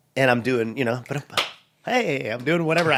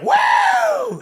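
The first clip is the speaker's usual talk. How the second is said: crowd whoop. Retold or imitated. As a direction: whoop like a whole bar cheering. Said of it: "everybody's like".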